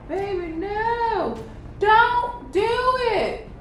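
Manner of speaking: high, slow pleading voice; drawn-out begging plea; Daquan pleading. Retold or imitated. Boyfriend begging her not to, high and slow. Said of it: "Daquan is like".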